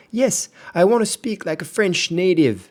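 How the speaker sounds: high, bright English voice of a keen learner; eager customer voice. Pretend. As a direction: pitch up and click yes with enthusiasm. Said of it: "vous n'avez plus qu'à faire".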